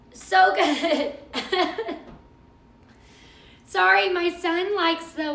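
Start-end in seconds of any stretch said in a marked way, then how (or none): 0.1-2.0 breaking up laughing on the words. Real, not acted. Cook tasting the dessert she just made, laughing. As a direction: let real laughter spill out after it. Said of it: "I'm laughing so hard".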